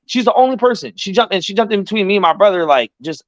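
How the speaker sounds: normal talking voice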